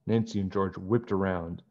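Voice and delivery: ordinary delivery, nothing marked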